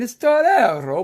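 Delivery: hammed-up Italian Torero with a lift at the end; Italian pronunciation. Shown as a demonstration. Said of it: "Or if you say it in Italian".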